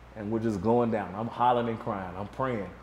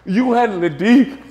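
higher, louder tearful plea; mock frightened cry for help; scared pleading; 10 semitones higher, 11 dB louder than usual. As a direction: imitate Troy begging with tears. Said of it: "Troy like".

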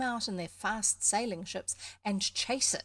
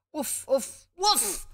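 imitated dog barks, high and loud; 5 semitones higher, 8 dB louder, much slower than usual. A narrator's reading. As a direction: bark like a dog, building to the last one. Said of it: "Then he barked loud".

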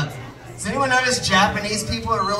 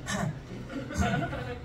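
throat clearing as impression; 4 semitones higher, 11 dB quieter than usual. Shown as a demonstration. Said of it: "This is my [name] impression".

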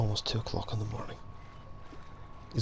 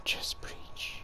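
whispered catchphrase; 8 dB quieter than usual. Real, not acted. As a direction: breathe it out without voice. Whispering catchphrase while hiding.

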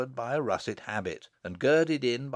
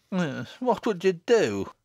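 high, slow, sour question; 7 semitones higher, 4 dB louder than usual. Acted. Mother challenging daughter's daydream, sour and high. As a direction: demand it sourly, drawn out.

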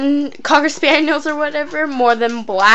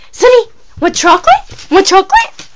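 squeaky and louder, teasing a dog; 9 semitones higher, 4 dB louder than usual. Real, not acted. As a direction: coax the dog in a high voice.

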